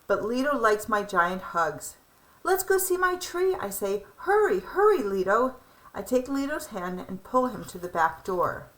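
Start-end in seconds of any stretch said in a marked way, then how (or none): 2.4-3.6 high, bright-eyed pleading; eager child. Acted. Eager child wants his tree. 4.3-5.6 high, urgent hurry-up; impatient excitement. Acted. Child rushing grandfather.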